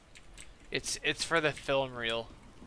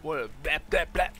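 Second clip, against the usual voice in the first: mocking their talk; 3 semitones higher than usual. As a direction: lightly mimic the guys' repeated hype greetings. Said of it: "they all talk the same".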